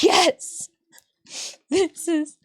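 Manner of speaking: ecstatic. Real, not acted. Gushing over the anime.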